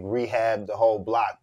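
usual speaking voice

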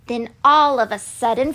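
plain reading voice

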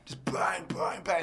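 breathy whispered sound effect bangs; whispered smashing bangs. Retold or imitated. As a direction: hush the bangs out. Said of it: "It was so loud".

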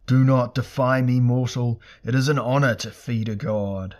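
loud, imperious command; roaring god. A narrator's reading. Burning god replies to Elric's defiance, roaring. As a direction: roar it like flames up a chimney. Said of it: "Like a roar of flames up a chimney".

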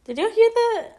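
alarmed, high and loud; startled by a noise, voice shooting up; real spooked outburst; freaking out. Real, not acted. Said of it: "you're just freaking out now".

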